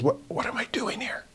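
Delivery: hushed, voiceless self-questioning; anxious whisper. Acted. Peter doubts himself among the enemy, in an anxious whisper. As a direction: whisper it as a sudden private doubt.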